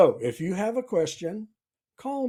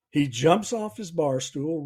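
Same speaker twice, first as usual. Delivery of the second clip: ordinary talk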